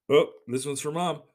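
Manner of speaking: ordinary talk, nothing marked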